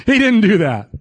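usual speaking voice

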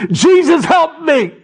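high, loud desperate plea; crying out. Acted. Blind men crying out to Jesus for sight, loud desperate shouting. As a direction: shriek it out to be heard by Jesus. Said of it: "They screamed, call aloud, shriek".

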